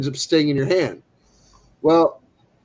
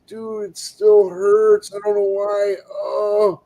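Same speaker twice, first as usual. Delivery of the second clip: drawn-out, higher complaint; pained whining; 4 semitones higher, slower than usual. Retold or imitated. Friend complains his stung hand still hurts, pained whine. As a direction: whine it slowly like a sore friend.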